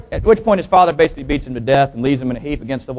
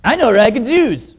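higher put-on Chinese accent; mock Chinese accent; 8 semitones higher, 5 dB louder than usual. Co-pilot getting back at pilot, mock Chinese accent. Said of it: "the Chinese guy looks over".

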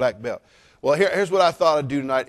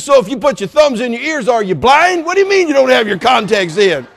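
louder, higher mock-exasperated question; exasperated father; 9 semitones higher, 9 dB louder than usual. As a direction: throw the absurd question back at her, loud. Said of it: "I said".

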